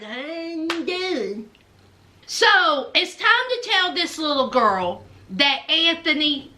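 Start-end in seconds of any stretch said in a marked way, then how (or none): 0.0-1.5 slow, hushed mock greeting; soft echoed greeting. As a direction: repeat the greeting softly, stretched out. Repeats the acted greeting, softly.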